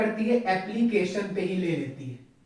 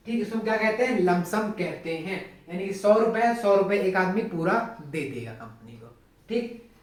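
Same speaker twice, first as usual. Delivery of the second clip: ordinary delivery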